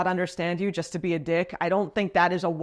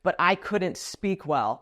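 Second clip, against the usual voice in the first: ordinary delivery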